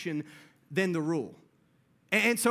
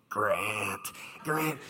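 spooky whisper-call; 10 semitones lower than usual. Retold or imitated. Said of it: "making this little noise".

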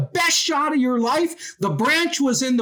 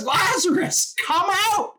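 a raised, higher call into the grave; commanding call; 7 semitones higher than usual. Retold or imitated. Jesus at the tomb calling Lazarus out, commanding and higher. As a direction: call the dead man out with authority.

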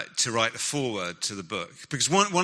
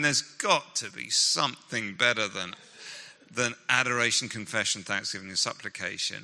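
normal talking voice

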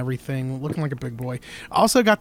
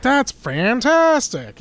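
excited; 8 semitones higher, 5 dB louder, much slower than usual. Retold or imitated. Delighted at the bargain.

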